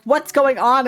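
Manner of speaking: mom calling out. Retold or imitated. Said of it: "my mom thinks we're fighting".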